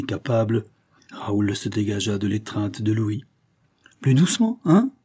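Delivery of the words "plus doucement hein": taunting, pitched higher and louder; provocative tone. A narrator's reading. Raoul taunts Louis after slipping out of his grip, provocative. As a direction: needle him with a sneer. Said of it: "du ton le plus provoquant".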